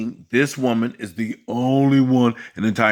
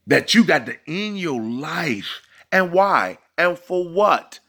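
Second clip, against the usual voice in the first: incredulous scolding; 4 semitones higher than usual. Retold or imitated. Coach imitating himself, scolding.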